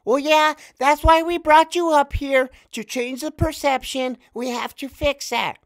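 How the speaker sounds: high, fake little laugh into a mock woman's voice; mock female boss. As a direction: play her fake sweetness with a lifted voice. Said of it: "she's like".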